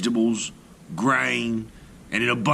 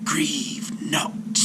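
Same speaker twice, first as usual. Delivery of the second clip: gentle whispered serpent; comforting serpent whisper; 3 semitones higher than usual. A narrator's reading. Serpent consoles the weeping sailor in a whisper. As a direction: soothe him in a snake's whisper. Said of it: "said the serpent".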